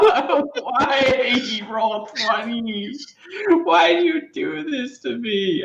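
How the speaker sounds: high, drawn-out complaint; exasperated wail. Real, not acted. Player pleading with the dice, high exasperated wail. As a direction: wail in playful despair at the dice.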